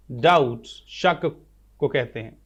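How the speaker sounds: usual speaking voice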